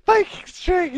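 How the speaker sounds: high, slow mocking cry of disbelief; incredulous mocking shout. Real, not acted. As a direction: repeat it in laughing disbelief. Mocking the phrase "baking string.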